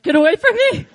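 loud frightened cry, laughing through it; scared yell. Retold or imitated. Preacher retelling fright on the beach, laughing yell. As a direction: shout him away in mock panic.

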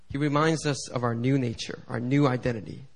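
ordinary delivery

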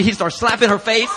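normal talking voice

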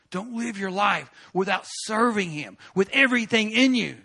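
booming inner command; voice in his head. Retold or imitated. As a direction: press it hard like a voice you can't shut out. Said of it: "And he was like".

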